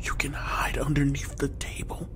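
hushed whisper while hiding. Real, not acted. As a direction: whisper so he won't hear. Hiding from Ronald.

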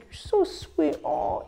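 very high put-on cutesy voice; exaggerated sugary little voice; sweet-talking voice. Shown as a demonstration. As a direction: coo it softly, way up high. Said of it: "You can even do that voice too".

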